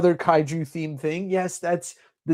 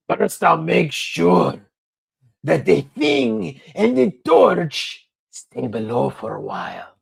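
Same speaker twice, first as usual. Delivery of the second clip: slow, louder, lightly put-on brute voice; a rough comic Hulk, drawn out; a hint of a lumbering monster; 4 dB louder, slower than usual. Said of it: "says the Hulk".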